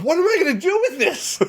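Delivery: very high-pitched comic protest; mock bewilderment. Tourist left holding a huge bag, squeaking in mock bewilderment. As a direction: play up helpless disbelief, voice shooting up. Acted baffled reply to the seller.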